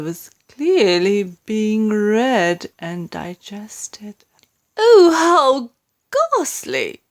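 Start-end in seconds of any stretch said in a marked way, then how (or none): 4.8-7.0 horrified cry. A narrator's reading. Queen reading the news, horrified cry. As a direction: gasp it out in horror. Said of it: "the famous voice cried out".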